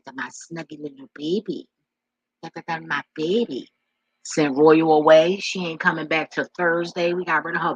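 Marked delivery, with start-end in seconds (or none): 0.0-3.7 doting pet voice. Real, not acted. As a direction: coo over your pet. Doting on her dog.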